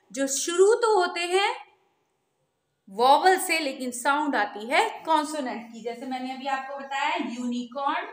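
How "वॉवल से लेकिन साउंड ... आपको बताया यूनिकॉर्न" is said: usual speaking voice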